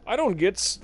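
ordinary talk

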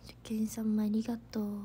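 soft whispered thanks; hushed, breathy thanks. Real, not acted. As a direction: whisper a quick thank-you aside. Thanking a viewer aside.

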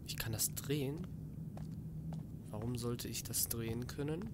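soft whisper to himself. Real, not acted. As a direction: whisper the discovery. Muttering while solving puzzle. (geflüstert)

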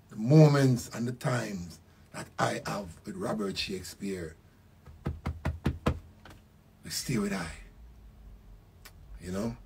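letting the grief pull the words down; quiet, moved remembrance; emotional. Real, not acted. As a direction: voice sinking soft and slow with feeling. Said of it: "that man me get emotional".

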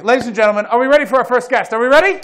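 loud, high boxing-ring introduction; announcer intro. Real, not acted. Host bringing out the first guest, loud, high announcer style. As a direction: build the crowd into the name.

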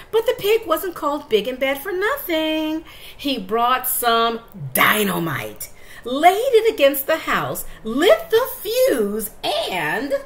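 loud, slow, building suspense; dramatic storytelling. A narrator's reading. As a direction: read it big and dramatic.

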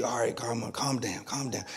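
soft, hushed calm-down; soothing baby. Retold or imitated. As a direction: quietly hush a fussing infant.

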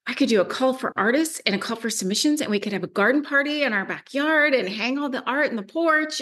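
quick, high-pitched rush of ideas; excited brainstorming. Retold or imitated. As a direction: gush the plan out fast, ending close to a laugh. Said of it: "got all excited about".

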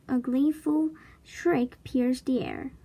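ordinary delivery, nothing marked

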